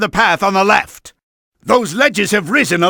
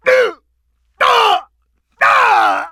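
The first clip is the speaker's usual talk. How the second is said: pained grunts; 13 semitones higher, slower than usual. Acted. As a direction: yelp at each blow. Boss taking hits.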